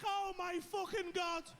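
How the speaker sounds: high, slow, drawn-out horror; acted stunned exclamation; appalled disbelief. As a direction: stretch out each word in dismay. Appalled reaction to screaming friend.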